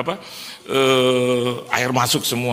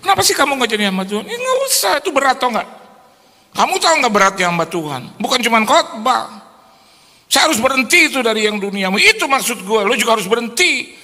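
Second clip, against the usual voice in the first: heated argument; 9 semitones higher, 3 dB louder, faster than usual. Retold or imitated. Acted marital argument.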